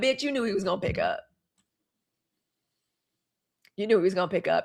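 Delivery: low, soft knowing retort; quiet aside. Real, not acted. Retorting quietly to Carrie's claim, low and knowing. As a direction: drop it low, like a muttered aside to the audience. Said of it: "retort to Carrie".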